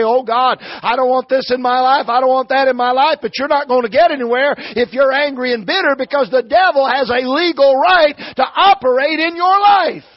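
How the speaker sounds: high, loud pulpit climax; full-force preaching. Real, not acted. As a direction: preach it hard, rising to a shouted last word.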